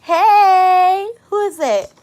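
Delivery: high, loud drawn-out hey; excited greeting. Real, not acted. Greeting someone who just joined, excited and loud. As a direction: call out to a newcomer, high and loud.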